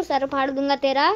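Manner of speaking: ordinary delivery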